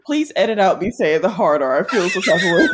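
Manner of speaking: low, laughing request; embarrassed laughing plea. Real, not acted. Host asking to cut her slip, laughing sheepishly. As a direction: plead through real laughter.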